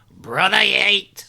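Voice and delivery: loud, slow gravelly bellow. Acted. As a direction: growl the name out like a gruff old trainer. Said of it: "How was that gravelly voice?".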